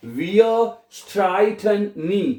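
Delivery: Wir schlafen nie spät, each word drawn out; slow teaching demo. Shown as a demonstration. Teacher modelling the German sentence, very slow. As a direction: model the sentence slowly for learners. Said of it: "अब यहाँ पे देखिए".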